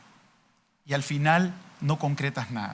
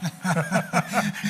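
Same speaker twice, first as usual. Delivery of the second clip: short amused laugh at his own joke; 5 semitones higher than usual. Real, not acted. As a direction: chuckle at the punchline. Risa tras broma de la nota. (risa breve y divertida)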